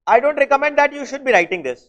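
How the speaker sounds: teacher warning class against writing it; deliberate warning. Real, not acted. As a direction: spell out the advice word by word. Teacher advising students not to write.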